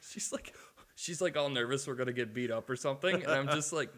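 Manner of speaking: ordinary talk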